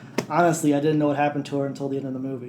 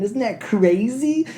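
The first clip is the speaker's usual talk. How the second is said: mock incredulous Portman; 8 semitones higher, slower than usual. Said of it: "Portman's just like".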